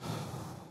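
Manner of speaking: long, soft audible breath; acted heavy breath before confessing; deep breath. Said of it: "took a deep breath".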